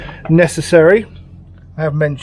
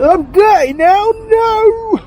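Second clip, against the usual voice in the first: mock wail; 5 dB louder, much slower than usual. High mock wail, getting dirty.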